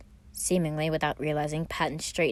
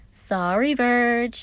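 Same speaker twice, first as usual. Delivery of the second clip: smiling apology; 7 semitones higher, 6 dB louder, much slower than usual. A narrator's reading. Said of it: "Patton apologized, laughing and smiling wide".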